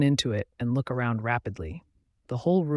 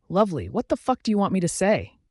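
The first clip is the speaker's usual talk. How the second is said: raised, indignant retort; high-pitched retort; 6 semitones higher than usual. A narrator's reading. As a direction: throw it back in a higher voice. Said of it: "she retorts in her high-pitched voice".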